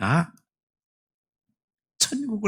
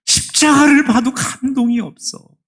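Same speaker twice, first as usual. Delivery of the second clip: loud, high, grieving cry; anguished lament; 11 semitones higher, 7 dB louder than usual. Retold or imitated. Spurgeon confessing his dead heart to his wife, anguished cry. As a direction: cry out the loss. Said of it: "이렇게 대답하더라".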